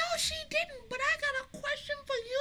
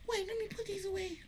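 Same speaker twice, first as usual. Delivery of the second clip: ordinary talk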